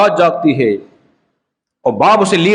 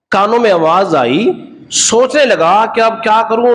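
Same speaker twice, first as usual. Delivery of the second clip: ordinary talk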